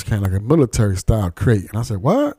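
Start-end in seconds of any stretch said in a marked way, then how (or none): 2.0-2.4 high-pitched incredulous "what"; retold reaction, voice jumping up in disbelief; surprised. Interviewer reacting to the Detroit man's crate story, surprised.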